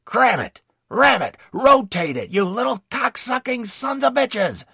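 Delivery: loud, high-pitched furious tirade; screaming abuse. A narrator's reading. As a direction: let it all out, screaming insults. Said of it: "Screaming and letting it all out".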